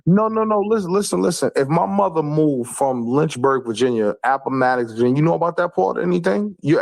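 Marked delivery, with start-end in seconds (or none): 0.1-1.5 high, insistent cut-in; pitched-up, repeated protest; urgent interruption. Real, not acted. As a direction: cut in and demand to be heard. Cutting in to correct the other.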